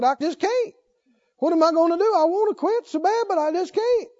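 high, pleading imitation of helplessness; mock-plead as if at wits' end; retold desperation. Preacher imitating the man wanting to quit smoking, desperate. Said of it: "came to me in desperation".

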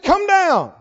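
high, loud disrespectful order; sarcastic. Acted. Captain relaying king's order to Elijah, sarcastic. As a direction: order him down in mock deference.

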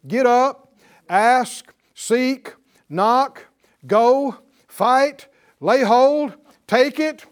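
urgent preacher's rallying call, word by word; rousing exhortation. Real, not acted. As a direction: punch each command out loud and high. Preacher urging listeners to act.

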